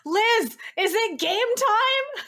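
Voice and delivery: high, loud, drawn-out hype call; hype man. Acted. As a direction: pump up the crowd like a hype man. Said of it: "me trying to be, like, a little hype man".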